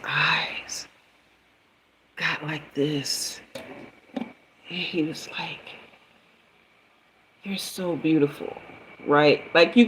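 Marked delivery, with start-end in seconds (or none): 0.1-5.6 hushed. Retold or imitated. Hushed build-up to his reaction. 7.4-8.6 soft awed compliment. Retold or imitated. Quoting his awed compliment.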